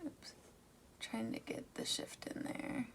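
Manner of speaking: soft whisper while concentrating. Real, not acted. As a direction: breathe it out under your breath. Whispered aside while swatching.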